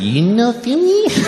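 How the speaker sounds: put-on very high, slow Japanese-accented question; exaggerated Japanese-accented waitress. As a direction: squeal it upward in a mock Japanese accent. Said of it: "I have to do the accent".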